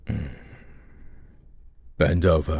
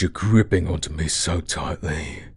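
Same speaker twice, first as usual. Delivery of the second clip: ordinary talk, nothing marked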